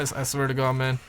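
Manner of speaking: ordinary talk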